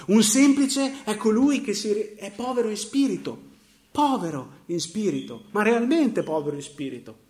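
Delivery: emphatic preaching. Real, not acted. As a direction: drive the point home to the congregation. Preacher hammers home key point.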